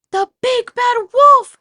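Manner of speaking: very high, very loud slow shriek. A narrator's reading. Second pig seeing the wolf, shrieking in fright. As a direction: shriek in terror. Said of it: "shrieked the Super Pig".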